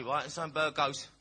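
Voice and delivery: normal talking voice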